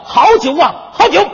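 high, loud delighted cry; hearty praise. Acted. Wu Song cries out praising the fine wine. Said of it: "不由得称赞道".

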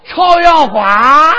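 slow, loud, laughing 朝阳花; drawn-out dialect word. Pretend. Punchline to the straight man, slow loud laughing word.

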